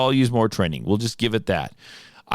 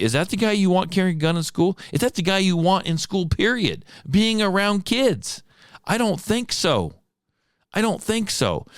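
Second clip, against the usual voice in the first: high, heated, demanding questions; indignant rant; 6 semitones higher than usual. Real, not acted. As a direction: hammer home that he's unfit. Ranting about an unfit guard.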